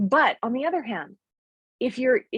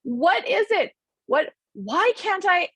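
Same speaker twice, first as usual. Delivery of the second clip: mock frustration; 10 semitones higher, slower than usual. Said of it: "And you've gone".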